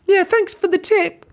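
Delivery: high, loud gloating pig; cheeky shout. Acted. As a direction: rub it in. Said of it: "shouted Sam with a little piggy snort".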